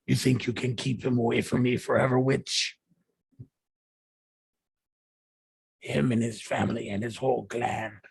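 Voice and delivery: lightly voiced possessing demon; a hint of a demon's voice; voice not his own. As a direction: speak through a possessed man, a touch menacing. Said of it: "a voice that is not his own".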